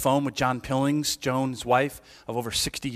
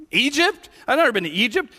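startled Joseph; 12 semitones higher, 6 dB louder than usual. Acted. Joseph reacts to angel.

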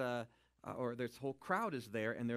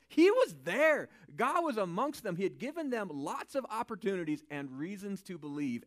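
higher, louder impassioned preaching; fervent preacher pushing the crowd; preaching at full force; 7 semitones higher, 7 dB louder than usual. Real, not acted. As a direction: build it up and press the point hard.

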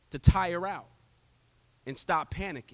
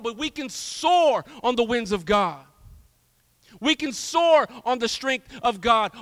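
slow, rising and louder on soar; building preaching; 3 semitones higher, 6 dB louder, slower than usual. Acted. Preacher lifting the congregation, slow and swelling. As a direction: preach it slowly, leaning on soar.

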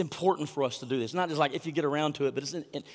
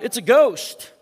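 terrified cry; 6 semitones higher, 8 dB louder, slower than usual. Acted. Disciples crying out in fear.

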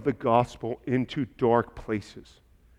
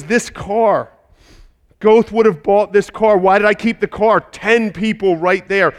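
anguished regret; 7 semitones higher, 9 dB louder than usual. Retold or imitated. Schindler breaking down over car.

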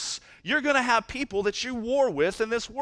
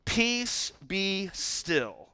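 commanding rebuke; 5 dB quieter, much slower than usual. A narrator's reading. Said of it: "rebuked the wind and said".

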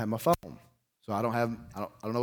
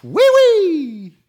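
much higher, much louder silly squeal; mock frantic panic noise; 13 dB louder than usual. Mocking frantic idea of power.

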